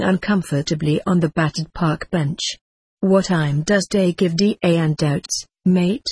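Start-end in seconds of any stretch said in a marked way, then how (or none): none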